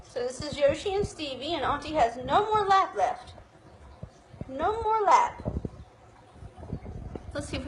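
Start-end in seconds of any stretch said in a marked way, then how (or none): none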